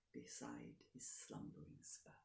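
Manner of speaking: hushed whisper. A narrator's reading. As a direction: whisper it so nobody wakes. Quiet night scene, sleeping spouse.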